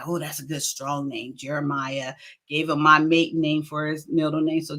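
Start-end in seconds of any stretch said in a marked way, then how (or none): 0.0-2.1 quiet musing. Retold or imitated. Her own thought about the name.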